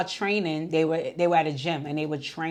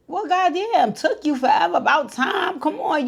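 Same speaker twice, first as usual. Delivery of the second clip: much higher and louder, excited whoop; hyped-up sons; 11 semitones higher, 6 dB louder than usual. Retold or imitated. Sons cheering their dad's engagement, high excited whoop. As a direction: cheer it like teens finally hearing the news. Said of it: "So they was all hype and excited".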